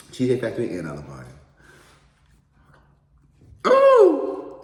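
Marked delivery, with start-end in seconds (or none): 3.7-4.6 very high loud delighted squeal. Real, not acted. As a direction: shriek with delight. Delight at cake.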